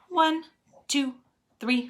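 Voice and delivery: plain reading voice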